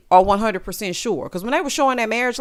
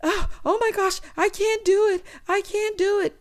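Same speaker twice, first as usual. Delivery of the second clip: mock panic; 10 semitones higher than usual. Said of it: "So she was".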